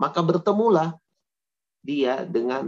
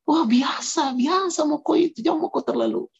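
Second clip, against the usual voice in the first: put-on high Makassar-accented peer pressure; 12 semitones higher than usual. Friends urging the kid on, high Makassar accent. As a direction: push them along, high, in a Makassar accent. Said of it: "ini pakai logat Makassar".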